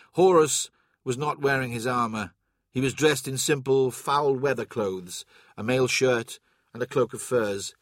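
plain reading voice

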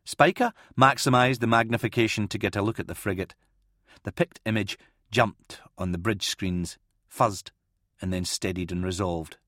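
plain reading voice

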